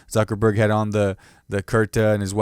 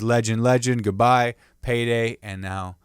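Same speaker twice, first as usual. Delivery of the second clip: ordinary delivery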